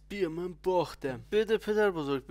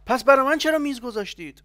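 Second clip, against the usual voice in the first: indignant yell; 9 semitones higher, 11 dB louder than usual. Acted. Complaining indignantly.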